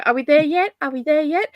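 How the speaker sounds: high, rising, sing-song pestering; whiny kids. Retold or imitated. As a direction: repeat it like a bored child in the car. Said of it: "when kids go".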